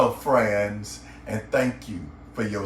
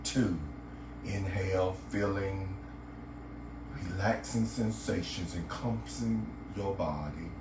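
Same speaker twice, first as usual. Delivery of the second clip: guided meditation; 5 semitones lower, 8 dB quieter than usual. Acted. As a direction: guide the listener gently into relaxation, lower and softer than a greeting.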